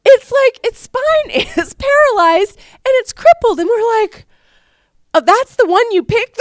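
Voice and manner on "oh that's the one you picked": dismayed surprise. Retold or imitated. Retold dismayed reaction.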